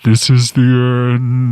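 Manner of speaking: slow, dramatic movie line; ominous movie quote. Retold or imitated. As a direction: intone it like a doomed film. Quoting Apocalypse Now ominously.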